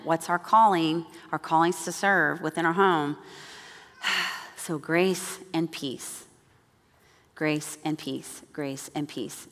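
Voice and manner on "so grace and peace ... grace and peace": soft, slow benediction; gentle blessing. Acted. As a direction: bless them softly and slowly.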